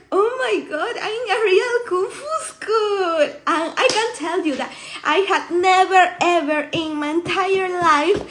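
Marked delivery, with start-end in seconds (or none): 0.1-3.2 higher, laughing disbelief; amazed realization. Retold or imitated. Telling of arriving at the school, amazed. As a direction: re-enact giddy amazement at where you landed.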